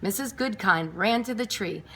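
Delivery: plain reading voice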